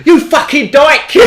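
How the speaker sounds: slow, high, drawn-out yell; retold furious shout. Driver in rage mode shouting at an old woman, hammed-up furious yell. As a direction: bellow it as if from a car window. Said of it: "and I just shout out".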